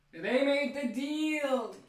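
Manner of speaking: high, slow, drawn-out mock voice; mock triumphant. Recapping the deal, hams it up in a high slow voice. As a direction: stretch "made" way up in a silly high voice.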